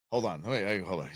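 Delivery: hushed whisper to himself. Real, not acted. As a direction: drop to a breathy whisper. Stops the clip mid-rant.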